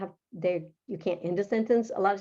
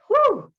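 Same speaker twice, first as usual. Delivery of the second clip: a big high whew of relief; loud whew; 14 semitones higher, 8 dB louder than usual. Real, not acted. Relief after hard explanation.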